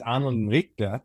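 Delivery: "Arnold and Richter" in a light German accent, R dropped. Shown as a demonstration. Colleague explains the German name in a German accent. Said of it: "poor but somewhat okay German accent".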